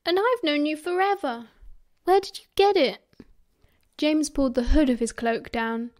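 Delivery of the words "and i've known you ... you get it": high, indignant squeak. A narrator's reading. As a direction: pitch it up like an eager boy's squeak. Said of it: "Peter squeaked".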